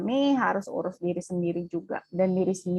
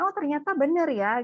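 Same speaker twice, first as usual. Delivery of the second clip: pitched-up surprised discovery; mock realization; 7 semitones higher than usual. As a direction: discover it, lifted high. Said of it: "begitu dipraktekin". (terkejut ternyata benar, nada tinggi)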